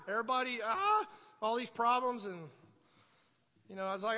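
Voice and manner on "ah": high mock yell of frustration; short, high-pitched cry of exasperation; exasperated "ah". Preacher replaying his fed-up feeling at the fire department, exasperated yell.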